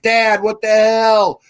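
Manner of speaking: slow, loud, high retold complaint; mock protest. Hungover kid woken by dad's clanging pots, mock outrage. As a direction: play up being rudely woken.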